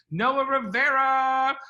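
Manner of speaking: high, loud drawn-out name call; slow, loud host intro; big announcement. Real, not acted. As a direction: announce him like a game show host. Announcing a panelist.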